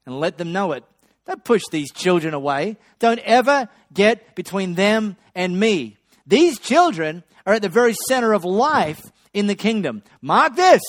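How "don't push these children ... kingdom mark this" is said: irate Jesus. Acted. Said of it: "But Jesus was irate".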